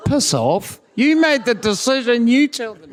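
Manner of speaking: high, loud mock indignation; indignant retort. Co-teacher refusing to announce his decision, indignantly. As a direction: throw it back at him, pitch jumping up. Retold retort to colleague passing the job.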